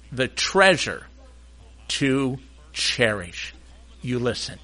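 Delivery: hushed dramatic intro. Acted. Pastor setting up the story, low and hushed. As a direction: draw the congregation in with a hush.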